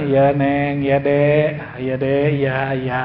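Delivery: calm her down, a husband who just says yes; meek placating husband. Retold or imitated. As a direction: Umar meekly answering his scolding wife. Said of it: "Umar bilang".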